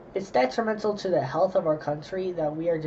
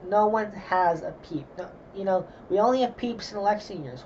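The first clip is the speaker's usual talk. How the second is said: ordinary delivery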